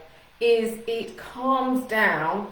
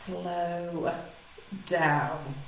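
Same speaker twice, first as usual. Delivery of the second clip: slow down. Shown as a demonstration. Coach introduces point two to viewers, slowly and deliberately. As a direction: stretch each word with a pause between. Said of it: "I'm really slowing my speech down".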